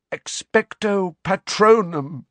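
high, slow, strained incantation; weakening spell cry. A narrator's reading. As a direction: cast it desperately as strength fades. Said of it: "His voice sounded dim and distant".

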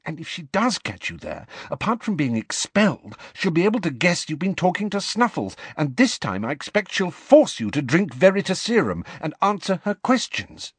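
high-pitched, lightly hushed nagging; hushed admonishing. A narrator's reading. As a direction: admonish a friend in a high, urgent undertone. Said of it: "keeping up a stream of whispered admonitions".

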